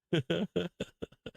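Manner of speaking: short real laugh; amused laugh. Real, not acted. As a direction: laugh it off in disbelief. Laughs at headline.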